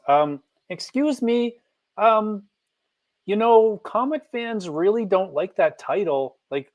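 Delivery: high, hesitant voice of a meek employee; timid boardroom objector. Pretend. Lone objector speaking up in a boardroom about a disliked title, timid.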